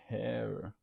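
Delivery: usual speaking voice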